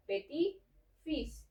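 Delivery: "petit fils" as "puh-tee feess", no final T; silent final T. Shown as a demonstration. Teacher gives word for grandson, "petit fils" with silent final T. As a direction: drop the last T of "petit" before "fils". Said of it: "pero aquí ya no".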